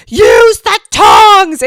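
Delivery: put-on loud, slow yell at a child; horrified yell at a kid grabbing food; shouted scolding. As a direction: bark it out across the buffet line.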